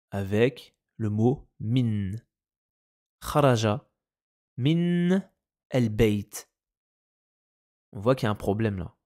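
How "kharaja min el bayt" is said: a clear pause after "Kharaja" and after "min"; word by word. Shown as a demonstration. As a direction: break between "min" and "el bayt", no linking. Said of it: "Je suis obligé de lire mot par mot".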